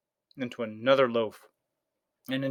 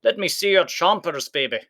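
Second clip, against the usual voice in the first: toothless giant; 7 semitones higher, 4 dB louder than usual. A narrator's reading. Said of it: "Cuchulainn said to Oonagh".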